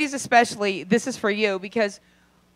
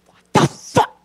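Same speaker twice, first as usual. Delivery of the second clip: appalled what the fuck; 5 dB louder than usual. Retold or imitated. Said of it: "And you're like".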